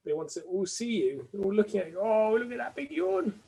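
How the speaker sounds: puppy voice. Real, not acted. Owner showing the puppy to viewers, in cooing puppy voice. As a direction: rise into a doting, high-pitched coo. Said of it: "changed my voice to puppy voice".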